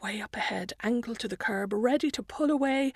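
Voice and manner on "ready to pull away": plain reading voice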